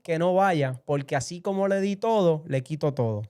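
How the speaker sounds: slowly weighted divine warning, building in intensity; voice of God. Retold or imitated. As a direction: relay God's command with rising force. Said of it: "Dios me habla en ese sueño y me dice".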